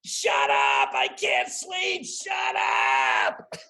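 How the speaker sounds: mock yell from a sleepless deer; furious woken-up deer yelling at the cars; angry deer shout. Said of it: "they're going".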